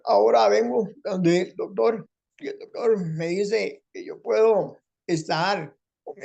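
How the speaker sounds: high, slow, strained voice with a catch; a grateful man in a high strained voice; Rafael Guillén's changed voice. Retold or imitated. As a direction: speak slowly in a pinched high throat voice. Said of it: "esta voz".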